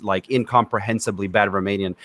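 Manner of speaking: ordinary talk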